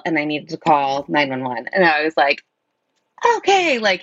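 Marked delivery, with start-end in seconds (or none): 3.4-3.8 high-pitched okay. Retold or imitated. Agreeing to call 911 after stroke.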